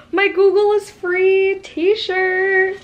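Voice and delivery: gasping, thrilled reveal; big excited gasp, slow. Real, not acted. Excited reveal of own merch.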